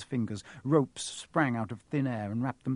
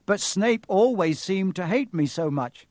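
put-on louder, higher boy's objection; raised, insistent boy's voice; urgent protest; 7 semitones higher, 8 dB louder than usual. A narrator's reading. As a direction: protest urgently to keep him talking. Harry anxiously keeps Quirrell talking.

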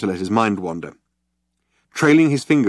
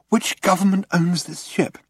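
curious Ford; 10 semitones higher than usual. A narrator's reading. Ford asks.

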